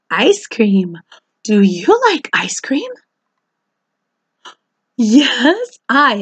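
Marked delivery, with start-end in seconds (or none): none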